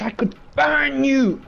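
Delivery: high, loud exasperated cry; frustrated outburst. Real, not acted. Gamer shouting at an annoying creature, high frustrated cry. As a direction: vent at the pest.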